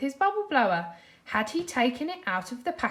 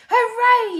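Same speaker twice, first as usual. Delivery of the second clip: high, loud cheer; excited yell; 13 semitones higher, 12 dB louder than usual. A narrator's reading. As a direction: burst out in triumph. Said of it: "yelled Patrick".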